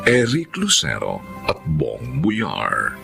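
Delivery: usual speaking voice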